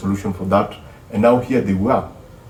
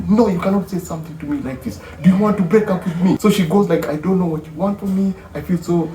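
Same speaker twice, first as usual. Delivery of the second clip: much higher voice that softens and fades; voicing the girlfriend; 6 semitones higher than usual.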